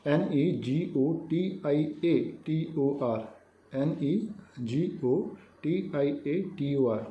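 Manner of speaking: letters of negotiator spelt out slowly, one by one; slow spelling. Shown as a demonstration. Teacher dictating the spelling to students, slowly. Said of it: "negotiator की spelling आप देख लेंगे".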